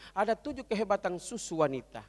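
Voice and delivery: ordinary talk, nothing marked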